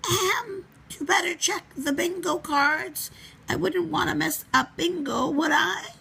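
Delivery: a hurried made-up excuse in a put-on granny voice; improvised excuse. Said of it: "improvised Mister Wolf".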